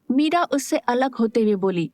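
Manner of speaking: ordinary delivery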